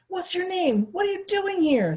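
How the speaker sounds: put-on high, loud angry holler at a boy. A narrator's reading. Foreman yelling at a kid on his fence. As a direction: yell down at the trespasser. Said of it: "the foreman hollered".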